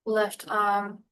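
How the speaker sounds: unhurried meditation guide with long pauses; calm, spacious guiding voice; guided meditation. Real, not acted. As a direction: ease them gently through breath and body, phrase by phrase.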